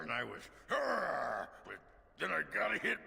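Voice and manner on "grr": high, loud playful growl; mock growl. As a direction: growl like a beast. Retelling his own growl.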